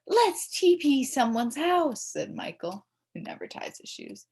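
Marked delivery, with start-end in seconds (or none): 0.1-2.0 put-on eager kid voice. A narrator's reading. Michael suggests a prank.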